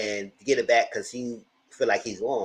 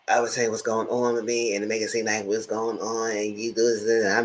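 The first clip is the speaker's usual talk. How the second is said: ordinary delivery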